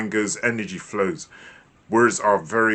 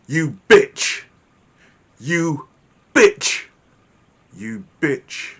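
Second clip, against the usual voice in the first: louder, higher, one word hissed; angry insult; 5 semitones higher, 5 dB louder, much slower than usual. Shown as a demonstration. Teacher shows how tone changes the insult. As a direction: snarl it, then hiss it. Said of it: "Can you hear the difference in the tone and the frequency".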